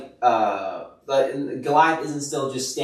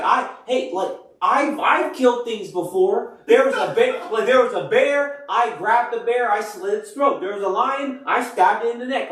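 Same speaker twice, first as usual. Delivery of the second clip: high-pitched, excited bragging; insistent retelling of past kills, pitched up; eager boasting; 7 semitones higher than usual. Retold or imitated. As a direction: act out a youngster proving he can fight. Acting out David boasting to Saul.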